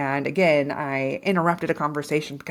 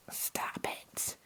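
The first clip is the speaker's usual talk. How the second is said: much softer whispered stop it; whispered self-command; 14 dB quieter than usual. Real, not acted. Telling herself to stop the shame, whispered. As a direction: hush yourself firmly. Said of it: "I'm telling myself that too".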